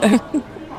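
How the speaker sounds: short quiet chuckle; soft laugh. Acted. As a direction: let out a small soft laugh. Brief soft laugh after a joke.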